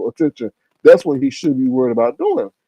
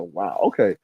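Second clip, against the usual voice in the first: low stunned deadpan; 9 semitones lower, 6 dB quieter than usual. Retold or imitated. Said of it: "I was like".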